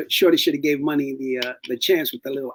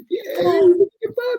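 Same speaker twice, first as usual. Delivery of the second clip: high, louder teasing mock voice; mocking husband; 5 dB louder than usual. Friend mimicking her husband, high teasing mock coo. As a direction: tease her like her husband would. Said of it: "Your husband will be like this".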